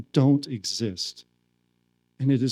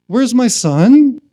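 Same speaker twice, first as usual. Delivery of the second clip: raised, loud, drawn-out question; calling out searchingly; 6 semitones higher, 9 dB louder, slower than usual. Retold or imitated. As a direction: call out as if hunting for someone. Swami playfully searches for him.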